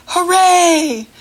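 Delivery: loud high hooray; cheering hooray. Shown as a demonstration. Teacher showing a happy children's hooray, loud and high. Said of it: "They also say".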